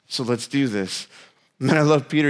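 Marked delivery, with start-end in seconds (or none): 0.1-1.1 softer and lower, calm encouragement. Retold or imitated. Jesus calmly urging Peter out, soft and low. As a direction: quietly say let's do this. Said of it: "Jesus is like".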